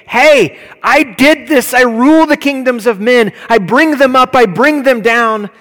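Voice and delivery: loud, high booming declaration; shouting God's message to the kingdoms; prophet's proclamation. Acted. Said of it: "he sent his prophets to say".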